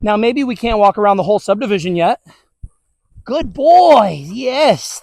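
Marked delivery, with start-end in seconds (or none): 3.2-5.0 dog praise. Real, not acted. Praising dog mid-walk.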